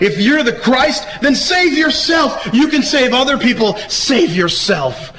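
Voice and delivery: high, slow sneering challenge; mocking taunt. Rulers taunting Jesus on the cross, slow high mockery. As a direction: drag it out to jeer at him. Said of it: "They begin to mock him".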